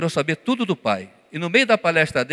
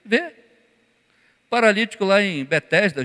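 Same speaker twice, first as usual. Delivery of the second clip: ordinary talk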